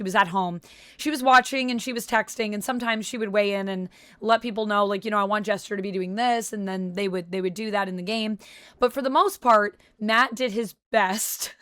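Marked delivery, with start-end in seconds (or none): none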